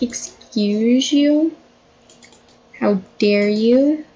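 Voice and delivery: slow, drawn-out questions rising at the end; mock indignation. Boyfriend confronts pregnant girlfriend, mock indignant. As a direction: stretch each phrase as if deeply affronted. Voicing boyfriend's reaction to her treat.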